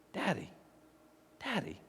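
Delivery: very soft, hushed call; tender little child. Retold or imitated. Little kid who loves his father, soft tender voice. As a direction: say it softly like a loving child.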